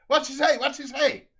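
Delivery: high, loud shout from the car; shrill-pitched yell demanding to be told; loud asking. Acted. Wife calls to husband through car window, loud high shout.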